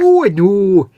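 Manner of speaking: loud, high Scottish crofter; hammed-up Highland Scots lilt; Scottish crofter's accent. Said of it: "a proper Crofter's accent then".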